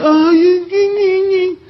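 high, nasal-free mock baby whine ñi ñi; mock whimper. Preacher mocks whiners with a childish high whimper. Mocking whining as baby noise.

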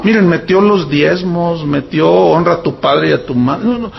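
usual speaking voice

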